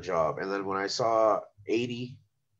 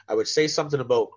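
normal talking voice

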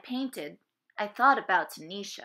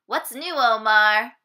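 calling out; 9 dB louder than usual. Acted. Calling out greetings.

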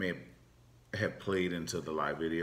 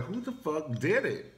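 mock outrage; 7 semitones higher than usual. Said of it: "you be like".

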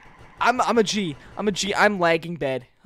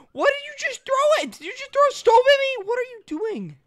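very high, squealing protest; incredulous outcry; 4 dB louder than usual. Real, not acted. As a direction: squeal at the friend who threw it. Stove thrown at speaker.

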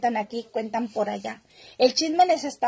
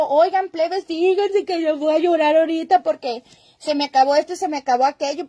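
higher, louder, mock-pleading voice of a streamer begging; mock whiny plea; 3 semitones higher, 3 dB louder than usual. Mocking streamers who beg followers for stars, in a mock whiny plea. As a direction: whine it like a fake beggar.

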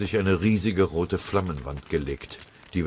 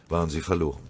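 usual speaking voice

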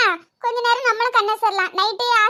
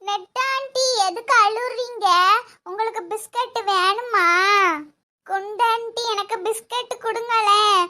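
put-on slow, drawn-out kid coaxing; five-year-old child; slower than usual. Child asking aunties for biscuits, childlike. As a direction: wheedle like a hungry small child. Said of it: "அஞ்சு வயசு குழந்தைதான்".

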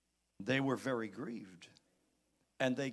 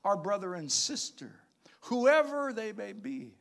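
high, loud pulpit push; full-force preaching; fiery preaching; 8 semitones higher, 7 dB louder than usual. Acted. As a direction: urge the church to forgive.

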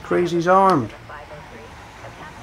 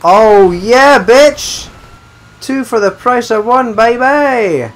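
triumphant yell; 8 semitones higher, 7 dB louder, much slower than usual. Real, not acted. Double kill celebration.

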